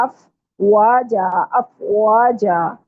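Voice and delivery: अफवाजा very slowly, waw as a rounded W. Shown as a demonstration. Teacher models recitation, slow rounded waw. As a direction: stretch अफवाजा, rounding lips on the W. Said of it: "वाव को गोल करना है".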